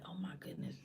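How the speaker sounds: barely voiced acted dismay; hushed inner thought. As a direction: whisper it privately to yourself. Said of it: "You start thinking about like".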